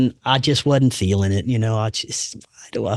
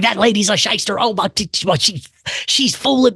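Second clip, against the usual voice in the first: mock angry rant; 9 semitones higher than usual. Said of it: "I was like".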